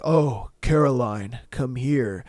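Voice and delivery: low, slow, smooth imitation of the voice actor; deep-voiced narrator. Host mimicking the voice actor's fan video, slow deep croon. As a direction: drop low and croon her name. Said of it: "he's just like".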